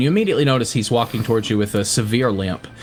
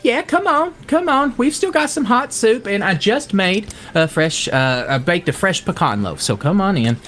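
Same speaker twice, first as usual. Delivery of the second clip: kind welcoming farmwife; 6 semitones higher than usual. Acted. Wilda offering soup and pecan loaf, kind eager welcome. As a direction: usher guests in as a kindly country woman.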